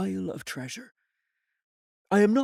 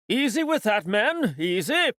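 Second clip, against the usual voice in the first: higher, louder call to the men; a captain's raised caution on deck; captain calling to crew; 6 semitones higher, 4 dB louder than usual. Acted. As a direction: warn the crew to go careful.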